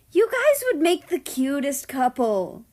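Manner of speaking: high, loud gushing squeal; squealing excitement. A narrator's reading. Japan squealing to America about a crush. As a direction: gush like a giddy matchmaker. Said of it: "Japan squealed again".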